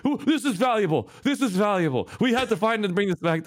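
high, gleeful, laughing "valuable"; greedy glee. Retold or imitated. Brother eyeing the gold in inventory, gleeful. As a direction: savor the loot with greedy delight. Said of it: "he's like".

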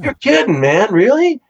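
put-on hardly believing old friends just walked in; a retold excited you're kidding; excited disbelief. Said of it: "I'm like".

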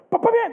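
high, punchy "ba-ba-bam"; rapid-fire sound effect, pitched high; imitated ignition noise. Preacher lights the guard's arms, imitated ignition noise.

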